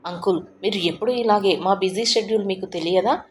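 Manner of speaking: ordinary delivery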